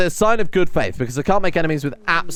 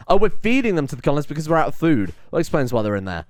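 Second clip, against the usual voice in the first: ordinary talk, nothing marked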